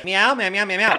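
high repeated meows; high-pitched meowing exercise; vocal warm-up. Shown as a demonstration. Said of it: "Okay, let me warm up".

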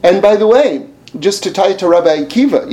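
usual speaking voice

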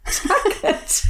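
breathy laughing echo; amused laughing repeat. Real, not acted. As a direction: laugh the name out.

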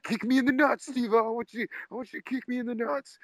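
very high squeaky heckler voice; drunk heckler. Retold or imitated. Heckler begging the comedian for a kick, squeaky goofy voice. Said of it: "he's like".

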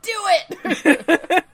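high, loud laughing "Do it!"; eager cheer. Retold or imitated. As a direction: egg him on while laughing. Mock Ando egging on.

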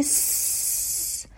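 س as a long hiss with no vowel; hushing long s. Shown as a demonstration. Teacher demonstrates telling someone to be quiet, drawn-out hiss. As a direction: hold س like shushing someone.

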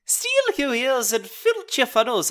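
loud, high, cheeky Irish voice; hammed-up Irish gnome; Irish accent. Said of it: "I went Irish there".

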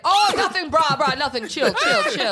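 nervous Boo. Retold or imitated. Boo caught out by Mario, squeaky nervous denial. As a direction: play a scared ghost brushing it off. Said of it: "And he's like".

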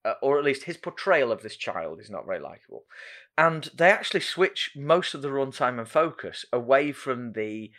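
usual speaking voice

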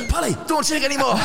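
high, loud mock shout of the coach; hammed-up coach yelling at a player; imitated coach yell. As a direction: bark it like a fed-up coach. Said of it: "And Archie's going".

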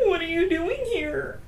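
lightly voiced goofy Shaggy; a passing Shaggy-ish apprentice. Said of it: "that kinda sounded like Shaggy".

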